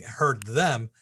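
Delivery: usual speaking voice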